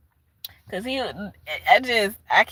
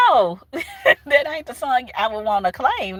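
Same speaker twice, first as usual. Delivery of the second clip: ordinary talk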